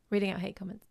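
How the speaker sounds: ordinary talk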